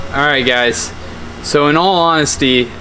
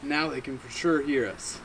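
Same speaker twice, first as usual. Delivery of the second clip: much softer, pleased with himself; quiet; 12 dB quieter than usual. Acted. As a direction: drop the voice right down. Newbie after scaring ducks.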